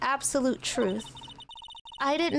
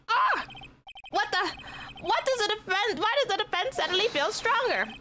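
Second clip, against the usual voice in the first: high-pitched, startled sputtering; flustered alarm; 9 semitones higher than usual. Acted. Rattled prosecutor reacting to a bolder defense, flustered. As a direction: sputter in panic, restarting the question.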